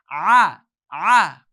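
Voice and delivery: "Ah, ah." loud with mouth wide open; throat ah. Shown as a demonstration. Teacher demonstrates the open throat ah. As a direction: open wide as if biting an apple. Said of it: "second part of the throat".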